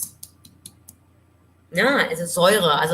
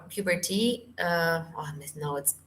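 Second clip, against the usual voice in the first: usual speaking voice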